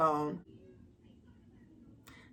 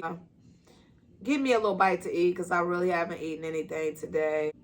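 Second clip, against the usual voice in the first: normal talking voice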